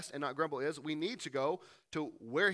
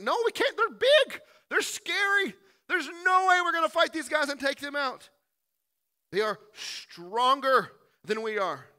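much higher, loud frightened whine; panicked protest; 10 semitones higher, 9 dB louder than usual. Acted. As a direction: squeal it like terrified spies. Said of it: "everyone's like".

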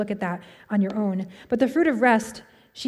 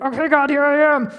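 exasperated prayer; 5 semitones higher, 6 dB louder than usual. Retold or imitated. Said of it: "it almost felt, like, ridiculous".

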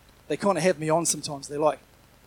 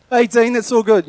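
mock-breezy tease, a lot louder; cheeky kids teasing; 5 semitones higher, 17 dB louder than usual. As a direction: toss it off like a cocky kid brushing off dad. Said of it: "So they're like".

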